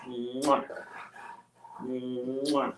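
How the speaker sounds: kissy smacks at the dog. Real, not acted. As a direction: blow the dog kisses. Kissing the dog.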